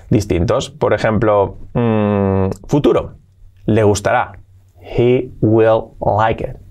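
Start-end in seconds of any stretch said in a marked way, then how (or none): 4.8-6.6 He will like it drawn out slowly; for learners to copy; slow model. Shown as a demonstration. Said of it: "slow model".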